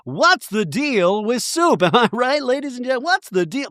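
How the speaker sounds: high, whiny observational-comedy voice; hammed-up stand-up routine cadence; stand-up comic. Mimicking a Seinfeld routine for listeners, stand-up comic. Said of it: "some Seinfeld thing with soup".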